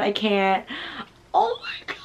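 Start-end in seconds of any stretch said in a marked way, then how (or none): 1.3-2.0 excited squeal. Real, not acted. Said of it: "I'm so excited".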